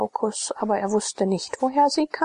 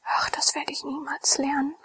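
sighing; 7 semitones higher than usual. A narrator's reading. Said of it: "seufzte Lydia".

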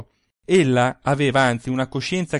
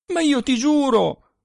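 higher, louder urgent protest; bursting out; 11 semitones higher, 4 dB louder than usual. A narrator's reading. Husband bursting out to swear to his wife, loud and higher. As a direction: blurt it out, loud and high. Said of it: "proruppe Stefano".

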